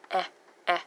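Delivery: clipped-off "Eh, eh", with a gap between; short E sound. Shown as a demonstration. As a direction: say "Eh, eh" as two brief vowels. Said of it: "this was the short E".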